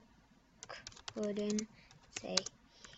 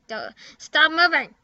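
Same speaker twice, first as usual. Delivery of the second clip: louder, higher stop moving; annoyed shout; 5 semitones higher, 7 dB louder than usual. Real, not acted. Louder and higher, telling a game character to stop moving.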